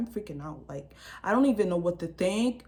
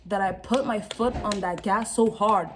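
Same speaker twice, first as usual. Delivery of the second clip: ordinary talk, nothing marked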